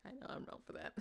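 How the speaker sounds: soft, breathy guilty admission; whispered aside. Real, not acted. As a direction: drop to a near whisper. Guilty aside after a joke.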